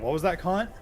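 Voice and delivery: high Aussie question with "Kant" tacked on; Australian accent. Retold or imitated. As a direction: toss "Kant" on the end, Aussie style. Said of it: "just throwing Kant at the end".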